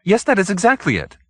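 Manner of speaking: high, relieved yes; finally understood, grateful; gratefully. Acted. Hoag thanking Mrs Randall, high and relieved.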